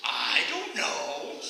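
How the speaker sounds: put-on very slow, drawn-out boy's reply; clueless boy. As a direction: drag out each word, unbothered. Acted boy repeating same answer.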